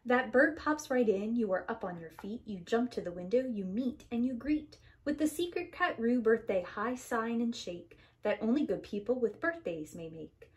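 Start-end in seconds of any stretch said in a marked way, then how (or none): none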